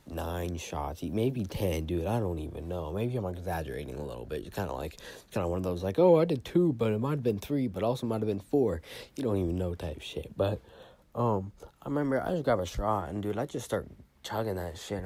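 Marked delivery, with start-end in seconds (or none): 6.0-8.8 high, loud mock voice trailing down; mock unsure partier. Mocking someone unsure how much they took, high voice. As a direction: play the guy who can't count his shots. Mocking vague drug user.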